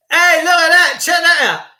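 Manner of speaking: proud of the silly trick; excited look-at-me; delighted show-off. Real, not acted. Shows off double glasses.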